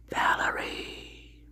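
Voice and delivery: breathy slow whisper of "Valerie"; whispered name. Real, not acted. Greeting viewers by name.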